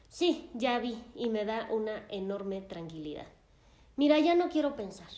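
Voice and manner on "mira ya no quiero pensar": higher, louder, fast exhausted plea; a retold patient cutting the talk short; overwhelmed. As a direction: blurt it out to stop thinking. Distraught patient on the phone.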